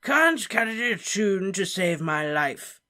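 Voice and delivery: put-on a jolly, befuddled old wizard; tipsy Slughorn. Tipsy Slughorn excusing his singing to Harry.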